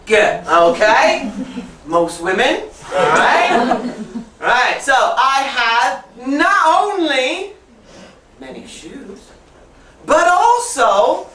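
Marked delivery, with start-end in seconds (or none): 4.8-11.3 slow, stressed "not only... but also"; stretched teacher emphasis. Shown as a demonstration. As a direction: lean hard on "not" and "also", slowly. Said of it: "is also an emphasis".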